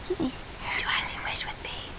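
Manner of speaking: whispering. Acted. Heroine offering herself.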